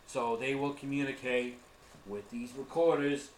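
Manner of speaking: normal talking voice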